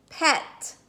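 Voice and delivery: ordinary talk, nothing marked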